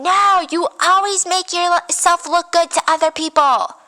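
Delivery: high-pitched retold accusation; mock screaming. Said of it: "she's screaming".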